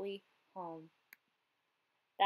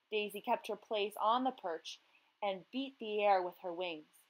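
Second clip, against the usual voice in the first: ordinary delivery, nothing marked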